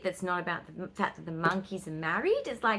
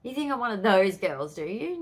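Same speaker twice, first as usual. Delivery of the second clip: mock offended; 4 semitones higher, 5 dB louder than usual. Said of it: "And I'm like".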